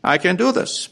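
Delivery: boastful king. Retold or imitated. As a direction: puff up like a proud king who thinks he can do anything. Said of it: "But he thought".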